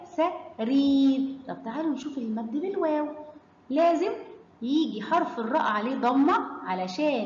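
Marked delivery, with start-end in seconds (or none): 0.0-1.4 slow سرير with a stretched ee; long vowel demo. Shown as a demonstration. Teacher shows where the madd is, drawn-out سرير. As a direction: linger on the yaa in سرير. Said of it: "مد بالياء".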